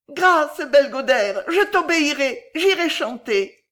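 high, loud cry for mercy; supplication. A narrator's reading. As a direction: beg in frightened surrender. Fille frappée demande grâce. (supplication aiguë, affolée)

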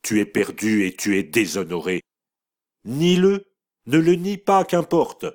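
voix dure qui monte jusqu'au cri; brutale. A narrator's reading. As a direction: jette l'accusation sans pitié. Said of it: "brutalement elle ajouta". (mère brutale qui exige le nom du coupable)

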